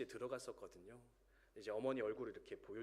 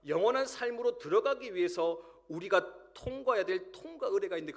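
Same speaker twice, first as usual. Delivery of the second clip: raised, louder pulpit voice driving the warning home; impassioned sermon delivery, louder and higher; full-force preaching; 6 semitones higher, 7 dB louder than usual. Acted. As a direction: preach it out loud to the congregation. Preaching the coming judgment.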